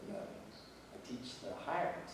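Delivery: slow, soft and hushed, nearly whispered; soft, tender reply. Retold or imitated. Telling the staff person, softly and tenderly. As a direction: say it gently, like a quiet truth.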